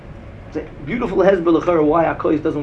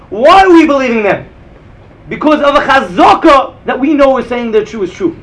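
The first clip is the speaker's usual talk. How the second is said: emphatic teaching; 5 semitones higher, 10 dB louder than usual. Real, not acted. Rebbe stresses the key question.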